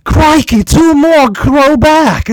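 loud, high, slow Aussie exclamation; Steve Irwin. Retold or imitated. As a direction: shout it like an amazed croc hunter. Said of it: "Steve Irwin just narrating".

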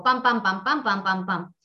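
rhythmic pam pam, like repeated game moves; video game sound effect. Retold or imitated. Copying a video game's repeated moves with rhythmic pams. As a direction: tap out the same move over and over. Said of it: "como un videojuego".

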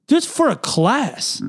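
high, slow, fed-up protest; a teen annoyed at a silly rumour; imitating his younger self brushing off a classmate; exasperated denial. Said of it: "I was like".

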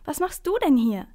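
high, loud delighted question; excited. A narrator's reading. Boy greeting the Sandman on the train, excited. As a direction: ask it thrilled and amazed. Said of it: "ruft Max dann begeistert".

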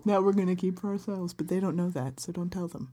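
a playful stage whisper fading away; conspiratorial aside. Acted. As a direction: lean in and hide it from the audience. Secret kept from the listeners.

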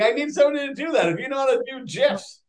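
high, loud delighted burst that rushes on; real excitement. Real, not acted. Guest thrilled at the offer of a meme, excited. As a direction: gush at a wonderful offer.

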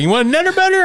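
high, chirpy offering voice; mock eager offer. People offering a Nutter Butter, high eager chirp. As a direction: offer it like it's a treat.

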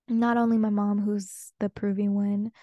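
ordinary delivery

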